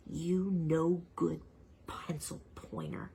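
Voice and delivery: soft, slow, unvoiced hush; menacing whisper. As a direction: seethe under your breath. Staring at sharpener.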